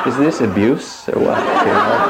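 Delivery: asked through laughter, high at the end; half-laughing in disbelief. Real, not acted. Teacher asks audience about the slap, laughing.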